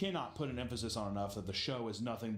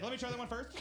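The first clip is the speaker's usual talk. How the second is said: much higher, laughing eager voice; eager mock guy; 10 semitones higher, faster than usual. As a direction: grab the cake first, giddy. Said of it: "He's like".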